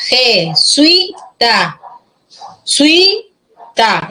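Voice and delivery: JESUITA very slowly, je-SUI-ta, with gaps; syllable by syllable. Shown as a demonstration. Teacher shows a grave word to students, syllable by syllable. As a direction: stretch and separate each syllable of JESUITA.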